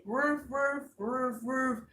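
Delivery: high doubled woofs; imitated dog barks. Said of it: "Even the dogs is out there".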